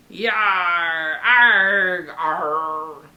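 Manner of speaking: loud growling yar and arg; pirate growls. Acted. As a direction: snarl like a pirate crew. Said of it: "snarled, and growled".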